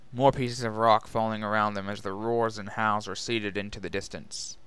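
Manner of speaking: plain reading voice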